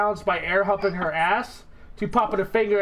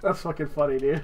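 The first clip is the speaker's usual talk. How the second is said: ordinary talk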